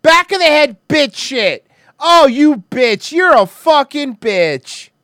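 high, loud furious yelling; shrill anger. Real, not acted. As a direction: scream it in disgust. Yelling at fight on video.

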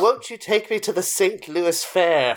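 put-on mid-Atlantic accent. Parodying old movies for cohosts, mock mid-Atlantic accent. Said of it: "seeing the mid-Atlantic accent".

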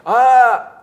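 high loud scream cut short; a sudden high scream; falling scream. Retold or imitated. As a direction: yell like you're dropping off a ledge. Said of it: "So I'm like".

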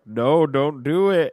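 hammed-up desperate appeal; mock pleading. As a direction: plead slowly and loudly to stop someone. Retold professor pleads with killer robot.

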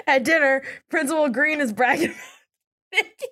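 high voice breaking into giggles; real helpless laugh creeping in; laughing while talking. Real, not acted. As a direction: try to get the line out through laughter. Cannot stop laughing while recapping.

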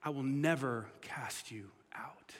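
whispered slowly, barely voiced. Real, not acted. Pastor hushing the promise to a struggling listener, whispered. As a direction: breathe the promise to them.

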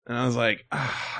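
normal talking voice

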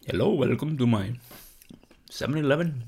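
mock cartoon Indian shopkeeper accent; Apu. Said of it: "like, Apu and".

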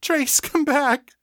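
high, slow, anguished begging; grief-stricken wail; sobbing plea. A narrator's reading. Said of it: "she sobbed".

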